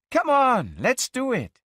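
put-on high, loud, eager girl; eager urging. Girlfriend urging him to hitchhike now, high and eager. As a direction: urge him on with excitement.

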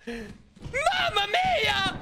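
stretched-out Mamma mia; slow drawn-out cry. Pretend. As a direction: drag out Mamma mia slowly.

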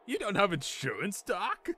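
put-on high-pitched incredulous boy; exclaiming. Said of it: "Marty exclaimed".

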